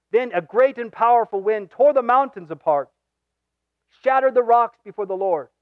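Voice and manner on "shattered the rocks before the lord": ordinary talk, nothing marked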